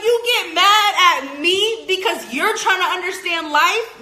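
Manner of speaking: laughing high and loud in disbelief; incredulous laugh. Retold or imitated. Vlogger laughs back at angry partners, incredulous. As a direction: scoff at them through a laugh.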